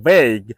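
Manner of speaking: vague said veyg, low and firm; correct pronunciation. Shown as a demonstration. Teacher modelling vague in a low firm voice. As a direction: drop low on a single syllable. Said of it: "সঠিক উচ্চারণ হচ্ছে".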